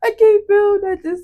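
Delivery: high, loud dramatic wail; hammed-up teen melodrama; drama queen. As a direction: overplay the helplessness. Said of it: "I was drama queen".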